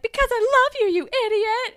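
high, loud, exasperated outburst; shouted confession. Retold or imitated. Recapper acts out Alex's confession to Paige, shouted. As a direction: blurt it out in a dramatic mock yell. Acted angry love confession.

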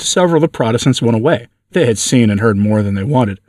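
ordinary delivery, nothing marked

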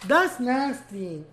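very slow, loud, disgusted; slow revolted complaint; drawn-out disgust. Real, not acted. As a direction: stretch every word out in disgust.

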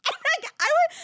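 high squeaky words through real laughing; helpless laughter. Real, not acted. Storyteller cracking up at his own panic, laughing squeal. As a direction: try to carry on while cracking up.

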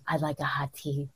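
very low, breathy, hushed husky rasp; husky laryngitis voice. Shown as a demonstration. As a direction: breathe it low like a sultry hotline voice. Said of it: "It's a very nine hundred".

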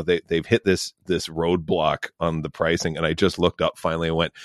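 normal talking voice

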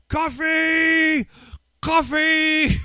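high, drawn-out rowdy holler; top-of-lungs yell. Retold or imitated. Four drunk friends demanding coffee at a diner, yelling. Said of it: "yelling at the top of our lungs".

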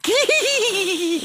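squeaky high doll voice. Pretend. Mocking grown man voicing doll.

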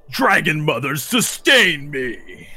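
loud hero's plea, last words stretched out; hammy, drawn-out battle prayer; dramatic invocation. Acted. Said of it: "and he goes".

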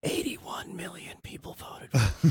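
hushed, drawn-out whisper; slow voiceless whisper for comic effect; mock whisper. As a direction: whisper it slowly under your breath. Whispered aside amid mocking banter.

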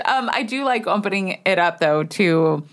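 normal talking voice

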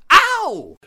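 sudden loud yelp; pained yelp. Acted. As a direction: yelp in pain. Hit again.

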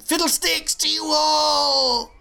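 very high, slow, loud comic yell; hammed-up shout. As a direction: bellow it like a wronged nerd. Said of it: "And then he just went".

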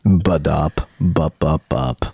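low, deadpan ad chant; deep jingle voice. Acted. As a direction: drop the jingle way down. Lower take on the jingle.

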